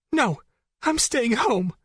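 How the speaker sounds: put-on high, breaking voice of a crying girl; voice cracking. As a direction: hold your ground as your voice cracks. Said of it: "Jess's voice cracked".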